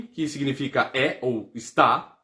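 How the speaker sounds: normal talking voice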